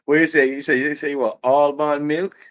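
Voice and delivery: high, loud Jamaican disbelief; Jamaican accent. Retold or imitated. Employee mishearing the customer's order, incredulous Jamaican accent. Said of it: "And the Jamaican's like".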